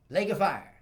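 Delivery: lake of fire bellowed high and loud; fiery shout. Real, not acted. Condemning the devil to hell, loud and high. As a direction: shout it like a preacher's damnation.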